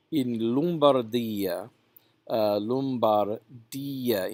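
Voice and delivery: "Lombardia" drawn out slowly, ending dee-uh; two separate vowels. Shown as a demonstration. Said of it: "pronounced as, as two vowels".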